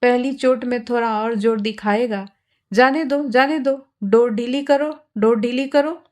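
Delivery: ordinary delivery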